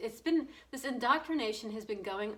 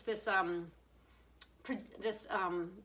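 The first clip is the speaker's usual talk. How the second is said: ordinary delivery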